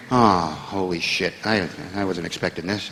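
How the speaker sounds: low, slow, hushed dread; panicked inner thought. Retold or imitated. A comedian learns he must meet the Queen, hushed low panic. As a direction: mutter it to yourself in sudden panic, dropping down low.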